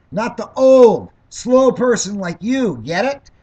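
loud, slow scolding shout; angry shout. Real, not acted. Scolding a heckler at debate.

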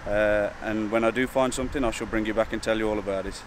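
ordinary talk

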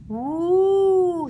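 high, drawn-out spooky woo; ghostly moan. Acted. Piglet hiding in the dark moans to scare his friends, ghostly woo.

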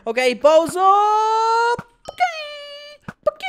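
high, slow straining shout; excited yell. Real, not acted. Gamer raising his bow mid-duel, high excited shout. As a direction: cry it out as the fight kicks off.

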